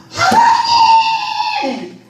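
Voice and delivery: high, slow, drawn-out anguished wail; wailing cry to God. Retold or imitated. Pastor wailing to God alone in her church, slow high cry. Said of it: "하나님 앞에 소리소리 지르는 거예요".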